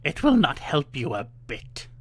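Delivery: slow harsh witch scolding; witch calling out. A narrator's reading. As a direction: sneer at her crying. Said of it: "the old witch called out".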